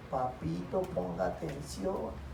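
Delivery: soft, slow and coaxing; tender fatherly God. Acted. God gently asking a child to pay attention, tender and slow. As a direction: say it gently, drawn out and quiet. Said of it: "como si Dios estuviera diciendo".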